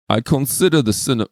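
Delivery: put-on a half-hearted stab at a Russian accent, quickly dropped; starts reading in a Russian accent, then gives up. Reading the Tsar's quote to his friend, a brief Russian accent attempt. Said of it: "I'm gonna do the accent".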